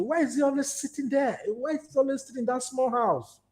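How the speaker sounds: a hint of a curious child; questioning child. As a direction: lightly imitate a child asking why. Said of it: "your child will ask you".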